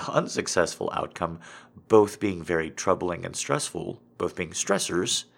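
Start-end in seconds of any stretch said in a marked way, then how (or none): none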